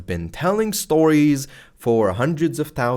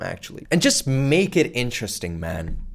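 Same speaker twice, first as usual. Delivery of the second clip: ordinary talk, nothing marked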